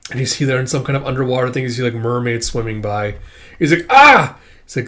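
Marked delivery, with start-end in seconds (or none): 3.9-4.4 short high, loud acted scream; sudden cry of alarm in character; yelp, pitched way up; startled yelp.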